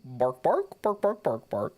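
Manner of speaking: barking like a dog with laughter; barking old lady. Acted. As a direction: bark it out as the witch. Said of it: "can you do an old lady voice".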